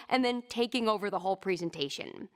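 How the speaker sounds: normal talking voice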